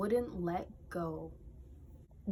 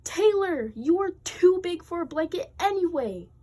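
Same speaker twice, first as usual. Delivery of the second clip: higher, louder angry yell; brother yelling; 9 semitones higher, 7 dB louder than usual. Acted. Jordan yelling at Taylor, angry. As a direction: yell back at her. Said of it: "Jordan yelled".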